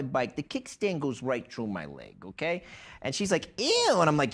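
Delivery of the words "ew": high-pitched mock ew; squealed-up kid disgust; disgusted child. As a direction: imitate a grossed-out kid. Retelling the girl's disgust.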